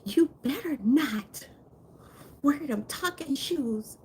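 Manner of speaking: dad's high-pitched telling-off; scolding dad. Retold or imitated. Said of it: "he sounds like the dad from Everybody Hates Chris".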